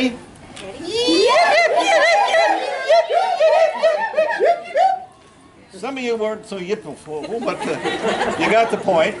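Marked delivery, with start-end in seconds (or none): 0.0-5.8 very high yipping yells, breaking into laughter; rebel yell. Shown as a demonstration. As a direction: lead the crowd in high yips. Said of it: "Are you ready?".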